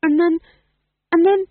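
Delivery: put-on embarrassed young woman stalling, flustered; flustered stammer. A narrator's reading. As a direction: she can't bring herself to say it.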